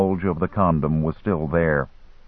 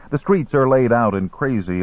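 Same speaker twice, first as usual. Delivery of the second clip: ordinary delivery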